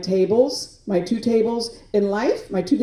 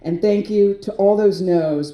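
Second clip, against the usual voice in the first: normal talking voice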